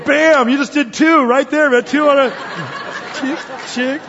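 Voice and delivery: loud, high triumphant shout; excited bam. Real, not acted. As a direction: cheer like a score. Excited exclamation.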